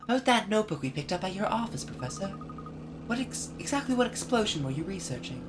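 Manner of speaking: put-on lifted, boyish sidekick voice; curious boy apprentice asking his mentor; young Luke voice.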